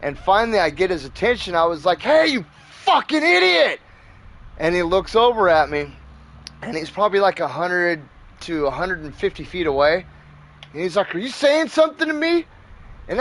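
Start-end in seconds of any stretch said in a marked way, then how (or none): 2.0-3.8 high-pitched holler across a yard; retold exasperated yell. Yelling at neighbour across yard. 11.1-12.4 high-pitched mock indignant question; puffed-up challenge. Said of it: "all puffy chested with me".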